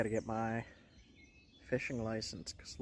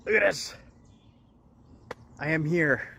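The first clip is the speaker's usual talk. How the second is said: excited; 5 semitones higher, 8 dB louder than usual. Real, not acted. Excited exclamation.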